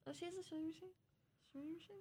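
usual speaking voice

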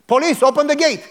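the cop yelling at the gate; police shouting. Retold or imitated. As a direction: order them to open up. Police demanding entry.

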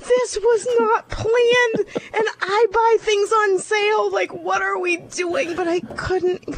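words squeezed out through real tears; holding back tears. Real, not acted. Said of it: "I'm sorry that I'm gonna cry".

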